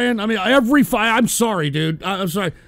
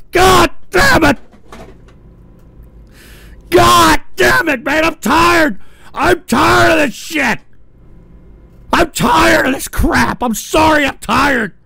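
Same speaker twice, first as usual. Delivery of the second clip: exasperated yell; 6 semitones higher, 6 dB louder than usual. Acted. Staged meltdown.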